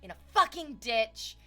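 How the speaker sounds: building to loud, slow doom; hammed-up, booming worst-case rant; melodramatic catastrophizing. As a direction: declaim each gruesome detail with relish. Catastrophizing inner monologue, acted out.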